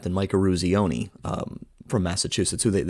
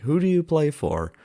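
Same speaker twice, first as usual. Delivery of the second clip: higher, slow, demanding coach voice; Brooks; 8 semitones higher than usual. Retold or imitated. Coach challenging the player about his team, imitated slow demand. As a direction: put the question slowly, with raised pitch. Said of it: "So this time he's like".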